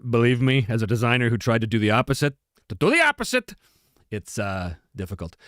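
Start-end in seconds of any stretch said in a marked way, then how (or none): 2.7-3.5 much higher, emphatic echo; high-pitched repeat. Real, not acted. Repeats own words for effect.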